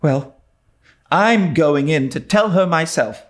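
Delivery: lightly voiced girl, firm and set; resolute. A narrator's reading. Anne to Diana, resolute. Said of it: "said Anne resolutely".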